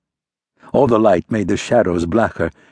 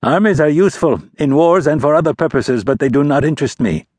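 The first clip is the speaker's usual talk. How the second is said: higher, louder dismissive aristocrat; airy disdain for armies; prince; 6 semitones higher, 3 dB louder than usual. A narrator's reading. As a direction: prince dismissing the army as dull.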